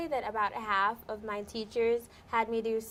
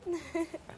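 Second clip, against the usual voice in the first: a soft high giggle; embarrassed little laugh; shy laugh; 6 semitones higher, 3 dB quieter than usual. Real, not acted. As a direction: laugh it off, relieved. Shy laugh after Spanish.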